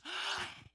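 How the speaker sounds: high, sharp mimicked gasp; shocked gasp. Pharmacist seeing the wrong dose, horrified gasp. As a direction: gasp in mock horror.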